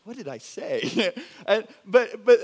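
laughing, baffled question; laughing confusion. Real, not acted. Pastor laughing at the story told to the congregation. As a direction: laugh at how it backfired.

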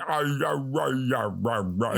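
mock Southern gibberish, barely talking; garbled Southern accent. Mocking an actor who could barely talk, garbled Southern accent. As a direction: imitate a hopeless Southern accent as wordless syllables. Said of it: "Can you do a Southern accent?".

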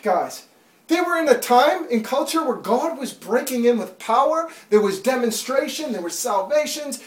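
high, loud urgent preaching; full-force preaching. Acted. Preacher urging the church to wake up, loud and urgent. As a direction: drive it home to the congregation.

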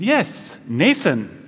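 badly faked Danish accent, "Nathan" as NAY-sun. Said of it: "that was not a good Denmark accent".

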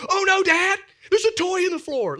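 put-on high, loud little-kid panic; mock alarmed child. Three-year-old son alerts dad to a toy, mock alarm. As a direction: cry out in exaggerated dismay, imitating a kid.

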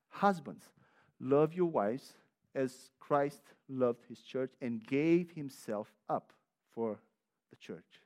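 slow, soft, solemn reading of the verse; scripture reading. Acted. Preacher reading Ephesians to the congregation, slow and solemn. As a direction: let the verse land slowly.

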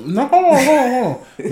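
high, urgent hold on; excited interruption. Real, not acted. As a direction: cut in, pitched high and eager. Wants to explain.

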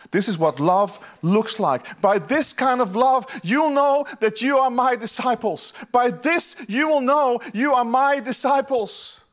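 high, loud and slow, each word pressed; preaching at full force. Acted. Pastor to congregation, voicing Jesus, preaching at full force. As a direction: proclaim it like the climax of the sermon.

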